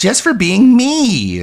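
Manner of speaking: high, slow mocking echo of his words; mocking DSP. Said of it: "S2 clip says it first".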